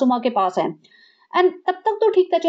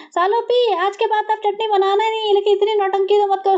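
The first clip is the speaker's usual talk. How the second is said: higher, pleading; mock exasperated sister; 6 semitones higher than usual. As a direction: beg her to stop the drama. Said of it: "महर कहते हैं". (ऊँची, झुंझलाई आवाज़)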